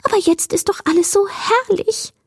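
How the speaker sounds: high, tiny creature voice; high-pitched wonder at the night; delighted little wisp. A narrator's reading. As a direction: enthuse like a small happy sprite.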